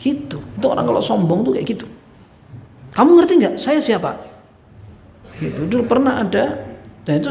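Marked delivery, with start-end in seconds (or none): 2.9-4.2 higher, louder haughty challenge; mocking arrogance. Arrogant official scoffing at his adviser, loud and haughty. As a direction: demand to know if they know who you are. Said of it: "dia nanya dengan ngece gitu".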